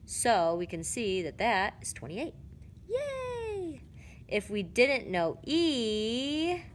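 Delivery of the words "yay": high squeaky yay of delight; pitched-up celebratory yay; excited cheer. Real, not acted. Cheering a solved answer.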